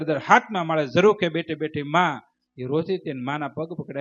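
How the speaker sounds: ordinary delivery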